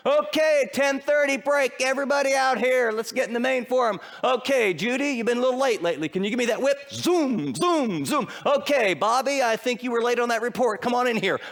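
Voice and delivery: high, loud mock slave-driver boss; harsh barking boss. Preacher mocking a cruel boss to the congregation, loud and high. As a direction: bark orders like a cruel overseer.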